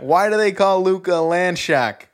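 loud, high New York questioning; New York accent. Pretend. Setting up the land shark bit, New York accent. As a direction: demand it in mock New Yorker. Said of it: "we had a New York accent".

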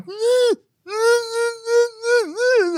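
very high crying nyeh with tongue out. Retold or imitated. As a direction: wail it with tongue sticking out. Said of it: "I had my tongue sticking out".

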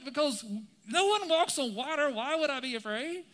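loud, high-pitched mock disbelief; sarcastic outburst. Peter protests that no one walks on water, sarcastic shout. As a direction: throw the question back, exasperated. Sarcastic reply to Jesus continues.

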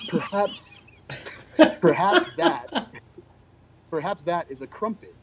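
mock musing with a laugh; musing over a mystery cookie; wondering to himself. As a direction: puzzle it out in a hammed-up musing voice. Musing to himself at the snack table.

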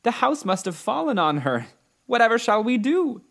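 put-on high, distressed little girl voice; dismayed. A narrator's reading. Dorothy realises the house fell on her, dismayed. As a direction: fret helplessly, pitch climbing. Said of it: "clasping her hands together in dismay".

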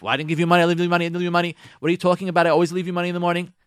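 husband protesting to wife about money; defensive husband. Pretend. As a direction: insist you always leave money. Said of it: "He's gonna defend himself".

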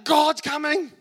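high, loud alarm call; lookout's shout. Retold or imitated. Lookout warning a sinful world that God is coming. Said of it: "someone's looking out".

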